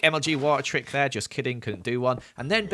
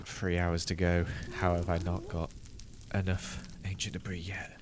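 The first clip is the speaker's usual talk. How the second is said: drained and softer; exhausted; 7 semitones lower, 8 dB quieter than usual. Real, not acted. Late-night exhausted aside.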